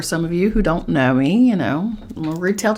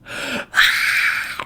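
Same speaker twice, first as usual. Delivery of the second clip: breathy hiss. Retold or imitated. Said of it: "and I went".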